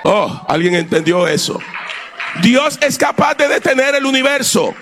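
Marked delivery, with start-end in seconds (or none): 2.4-4.7 high shouted proclamation climbing to a peak; fervent pulpit shout; full-force preaching. Acted. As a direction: preach to a climax, stretching the final command.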